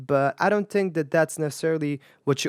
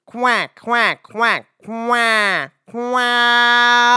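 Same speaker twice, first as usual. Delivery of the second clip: higher, louder twangy quacking; duck quack; 8 semitones higher, 6 dB louder, much slower than usual. Shown as a demonstration. Teacher imitating a duck's real quack. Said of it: "It says".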